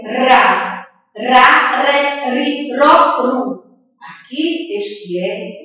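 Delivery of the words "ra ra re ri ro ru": teacher's syllable chant of "Ra, re, ri, ro, ru"; R pronunciation drill. Shown as a demonstration. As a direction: recite "Ra, re, ri, ro, ru" as separate syllables. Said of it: "Sentiu a pronúncia do R?".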